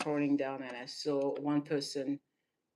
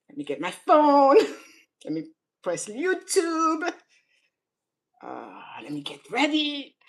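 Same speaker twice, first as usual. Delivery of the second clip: higher, louder mock-eager voice; mocking a fake crier; 9 semitones higher, 7 dB louder than usual. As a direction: parody someone setting up to fake-cry. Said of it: "I'm making fun of those people".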